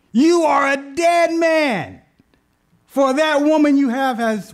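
loud, slow shouted doom from heaven; God's booming threat. Acted. God shouting at the king in a dream, slow and loud. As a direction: boom it down like a judge from the sky.

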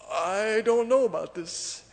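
slow, high, wary doubt; mock uneasy disbelief. Preacher voicing disciples facing risen Jesus, mock unease. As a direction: drag it out high, playing unsure.

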